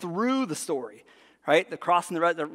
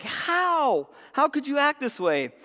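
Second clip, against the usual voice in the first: high, incredulous protest; acted outrage; 9 semitones higher, slower than usual. Servants confronting the forgiven man, high incredulous outrage. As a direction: wail the question in indignant disbelief. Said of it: "and they're outraged".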